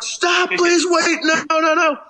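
very high, pleading mock yell; retold desperate yelling. Passenger begging departing bus drivers, desperate yell. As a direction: plead at a high pitch, as if calling out. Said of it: "I'm, like, yelling, like".

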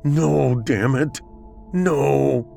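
panicked frustration. A narrator's reading. Fugitive hears the sirens coming, panicked and frustrated. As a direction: cry out in high-pitched dismay.

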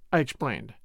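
ordinary delivery

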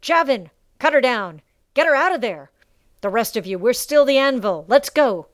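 rally the troops, full voice; commanding shout. A narrator's reading. Karl rallying his men in battle.

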